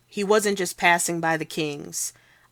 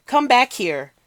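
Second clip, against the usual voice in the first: higher, louder call; angry shout; 7 semitones higher, 6 dB louder than usual. Acted. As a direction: yell after the runaway. Clerk chasing thief.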